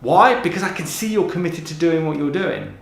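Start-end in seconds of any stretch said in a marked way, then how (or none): none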